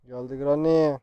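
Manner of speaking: sudden loud warning that she's here; alarmed call. Real, not acted. Game enemy Granny appears. (telaşla yüksek sesle)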